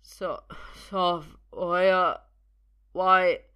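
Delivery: halting slur swelling to loud effort; laboured, desperate stammer; fighting to speak. Acted. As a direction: force out broken words, drugged. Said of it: "has to fight to speak again".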